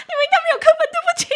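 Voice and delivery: very high, squeaky laughing voice; loud squeal of amused apology. Real, not acted. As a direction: gasp it out through helpless laughter.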